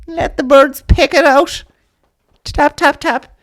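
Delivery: high, slow, heartbroken eccentric lady; pigeon lady voice. Acted. Comic telling the audience, as a high batty pigeon lady. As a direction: pitch it way up and drag it out like a batty bird woman.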